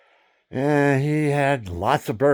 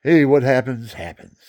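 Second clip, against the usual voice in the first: casual saying; 2 semitones higher, 7 dB louder than usual. Retold or imitated. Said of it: "You ever hear that one?".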